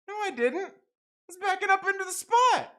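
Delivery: very high, loud protest; exasperated, hammed-up arguing; acted heated denial. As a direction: replay an indignant defence at full pitch. Replays his heated denial.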